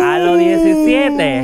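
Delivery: high, drawn-out exclamation; high, slow, scandalized teasing; teasing astonishment. Real, not acted. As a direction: stretch it out high in playful shock. Teasing shock at his confession.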